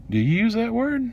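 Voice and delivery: high, rising, probing question; pointed question. Retold or imitated. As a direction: ask it softly up high, challenging. Retold pointed question to manager.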